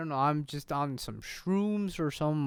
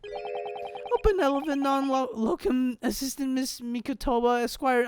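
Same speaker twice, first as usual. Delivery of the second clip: high-pitched put-on voice; high, sustained assistant impression; assistant's voice; 6 semitones higher than usual. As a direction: struggle through it in a high character voice. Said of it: "I cannot say that in your voice".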